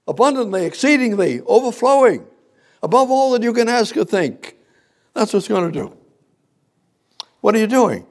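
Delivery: very high, loud fervent preaching; preaching at full force. Real, not acted.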